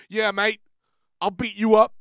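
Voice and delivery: loud, cocky mock-English threat; Funball impression. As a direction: throw it out high and loud, spoiling for a fight. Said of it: "has that attitude that's always just like".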